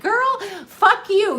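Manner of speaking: put-on high, slow, sassy "Girl" quote. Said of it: "and he's like".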